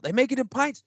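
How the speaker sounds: very high, wonder-struck little voice; high-pitched mock astonishment; amazed hobbit. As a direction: play a thrilled hobbit. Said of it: "Like a fucking hobbit over there".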